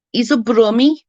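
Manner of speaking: amateur Brummie impression; Brummie accent. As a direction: attempt a Brummie lilt, badly. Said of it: "I'm not very good with accents".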